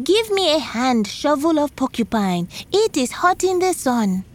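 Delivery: high copied call to the shovel; shouting. A narrator's reading. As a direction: shout it up like Porcupine did. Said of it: "shouted the same thing he heard Porcupine say".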